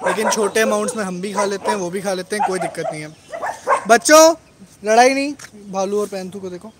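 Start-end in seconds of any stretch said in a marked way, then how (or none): 3.9-5.3 sharp raised call to stop a dog fight. Real, not acted. Owner shouting at his fighting dogs to stop. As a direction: shout across the room to break it up.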